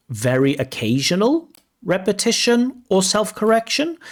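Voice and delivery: high, rising, incredulous; doubtful question. Shown as a demonstration. Examiner doubting the descriptor for viewers, incredulous rise. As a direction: question the wording in disbelief.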